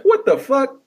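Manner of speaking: a stunned yelp when Jesus never shows; mock shocked outburst. Ranting comic imitates believers left behind, shocked yell. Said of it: "how many people would be like".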